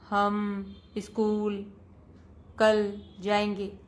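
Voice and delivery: slow, word by word, "हम school" then a pause before "कल जाएंगे"; choppy wrong reading. Shown as a demonstration. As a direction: read it haltingly, one word at a time. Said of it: "तो हम ऐसे ना पढ़कर". (धीमे, अटक-अटक कर शब्द-दर-शब्द पढ़ना)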